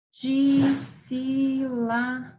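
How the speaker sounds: DISSÍLABA drawn out slowly, each syllable held. Shown as a demonstration. Teacher splitting Dissílaba for pupils, very slow syllable by syllable. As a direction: stretch Dissílaba into separate long syllables. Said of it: "Essa é uma que eu separo".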